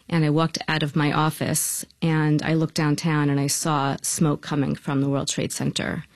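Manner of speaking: ordinary talk, nothing marked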